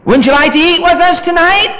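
high, sweet invitation; coaxing parent. Retold or imitated. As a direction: coax the child to dinner.

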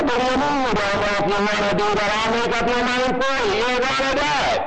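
fast, forceful scolding from an angry parent; overriding the child, brooking no argument; stern parent. Retold or imitated. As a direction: lay down the law to the balking kid. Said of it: "you don't have to say it like that. I'm preaching".

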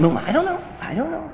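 small, high, soft voice; quiet, high imitation of uncertainty; mock meek answer. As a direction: shrink into a sheepish reply. Acts the unsure believer answering.

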